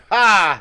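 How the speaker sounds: a sudden loud laugh; loud burst of laughter. Real, not acted. Laughing at her own crashed party joke, loud burst of laughter.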